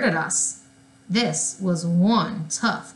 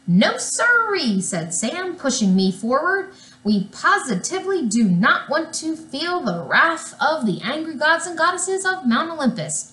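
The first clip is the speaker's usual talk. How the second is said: high, emphatic, over-keen agreement; eager placating; 7 semitones higher than usual. A narrator's reading. Sam pushes his friend forward, eagerly placating angry gods. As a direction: echo the threat back with anxious eagerness.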